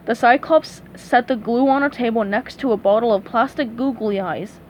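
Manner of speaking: ordinary delivery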